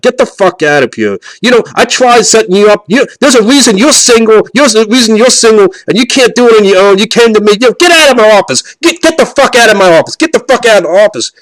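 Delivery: imitating a flip-out. Matchmaker Patty screaming at the failed client to leave. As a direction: scream him out of the office. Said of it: "That's literally what she said".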